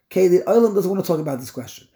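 ordinary delivery

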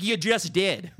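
retold argument, pointed contradiction; exasperated retort. Patient catches provider contradicting herself, exasperated retort.

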